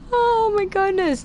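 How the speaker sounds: normal talking voice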